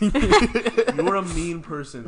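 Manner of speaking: laughing through the words, drawn out; real laugh mid-insult. Real, not acted. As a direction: tease while cracking up.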